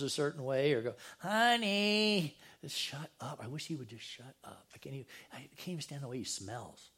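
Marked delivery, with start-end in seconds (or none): 1.2-2.4 mock drawn-out "honey", pitched up; exasperated spouse. Upset spouse calling their partner, mock exasperation. Imitating an irritated married person. 2.7-6.9 imitating a spouse grumbling under the breath; low, hushed mock grumble; muttered irritation. Upset spouse who can't stand the partner, muttered irritation.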